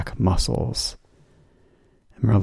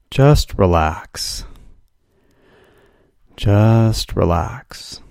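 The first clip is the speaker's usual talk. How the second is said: soothing; much slower than usual. Shown as a demonstration. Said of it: "by saying silently to yourself".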